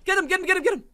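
high, loud rapid-fire repeats; urgent urging. Real, not acted. Player to teammate mid-fight, urgent urging.

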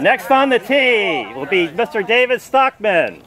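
loud, slow announcer voice; booming tee-box introduction. Acted. Announcing next golfer.

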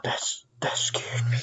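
breathless frightened whisper, then sigh; shaken whisper. Real, not acted. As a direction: whisper it, still rattled from the scare. After jump scare, shaken.

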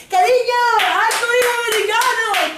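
very high, loud squealed mimicry; sarcastic falsetto parody; mocking mimicry. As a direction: stretch it slowly in a shrieky taunt. Sarcastic mimicry inside acted quarrel.

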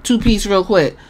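ordinary delivery

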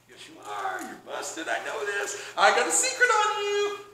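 taunting gloat. Acted. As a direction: tease them with their secret, pitch climbing. Acted gloating, escalating over a secret.

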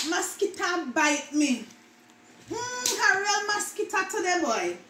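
lively patois complaint; Jamaican patois. Shown as a demonstration. Vlogger acting out a mosquito complaint in patois. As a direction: grumble it Jamaican style. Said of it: "words that Jamaican mispronounce".

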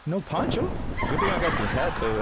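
high, loud surprised outburst; surprised exclaim. Real, not acted. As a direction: blurt it out in surprise. Rained on, no poncho.

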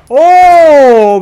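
loud, high amazed "Oh!"; excited shout. Real, not acted. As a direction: burst out in amazement. Streamer reacts to game moment. (grito de asombro)

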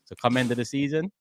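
usual speaking voice

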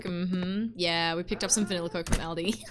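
ordinary delivery

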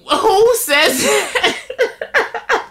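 high, drawn-out question breaking into laughter; cracking up in disbelief; incredulous laughing. Real, not acted. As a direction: squeal it slowly through real laughter. Laughing at grandpa's blunt remark.

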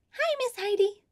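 high squeaky puppet voice. Acted. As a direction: pitch way up like a child puppet. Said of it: "my friend Dottie".

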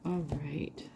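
breathy whispered aside. Real, not acted. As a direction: whisper it under your breath. Murmuring to herself while reading.

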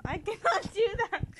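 high, loud amused protest; loud high wail of defeat; exasperated outburst. Real, not acted. Giving up on a laugh.

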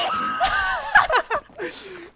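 high shouted laugh; big high laughing fit. Real, not acted. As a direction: howl with laughter. Laughing hard at teammates.

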